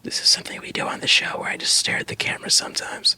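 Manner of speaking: unvoiced whispered aside. Real, not acted. Whispering an aside to the camera about staring. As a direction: breathe it to the camera like a secret.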